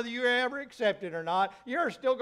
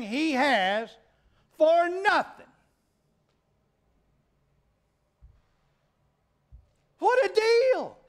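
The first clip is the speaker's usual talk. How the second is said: higher, louder, slower exultant cry; preaching climax; 7 semitones higher, 5 dB louder, slower than usual. Real, not acted. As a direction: build to a joyful preaching shout. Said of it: "climax of sermon".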